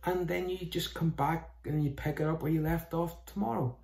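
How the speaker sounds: ordinary talk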